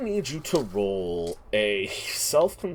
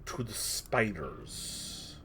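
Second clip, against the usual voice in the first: slow, hushed, eerie narration; suspenseful forest description, spoken slowly; game master scene-setting; 2 semitones lower, 6 dB quieter, slower than usual. Acted. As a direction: draw out each detail softly.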